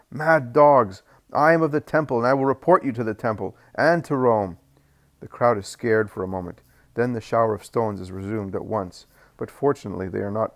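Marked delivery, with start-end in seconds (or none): 0.1-4.6 loud, high furious threat; defiant shout. Acted. Judas shouts threats at the stone-throwing crowd, loud and defiant. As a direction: bark it at the mob. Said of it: "he shouts to the crowd".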